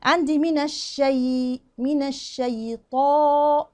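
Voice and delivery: الشيطان drawn out slowly, nun and shin kept thin before the heavy ta; slow tajweed demo. Shown as a demonstration. Quran teacher slowly showing students الشيطان, thin shin before heavy ta. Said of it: "طاء أعلى درجات تفخيم".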